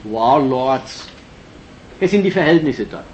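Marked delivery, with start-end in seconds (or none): none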